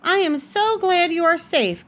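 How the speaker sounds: high, relieved outburst; relieved cry. Acted. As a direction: burst out with joy to see her safe.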